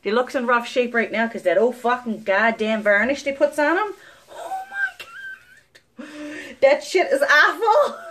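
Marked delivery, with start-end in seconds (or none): 4.3-8.1 cry-laughing, high and slow. Real, not acted. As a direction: laugh through the words. Laughing about dental varnish.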